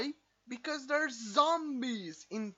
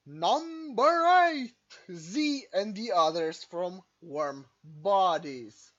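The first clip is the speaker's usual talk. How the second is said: countdown announcer; 6 dB louder, slower than usual. Acted.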